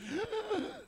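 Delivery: sharp high gasp of astonishment; surprised gasp. Retold or imitated. Preacher hearing the student now goes to church, startled gasp. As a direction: gasp as if stunned. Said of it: "엄청 깜짝 놀랐어".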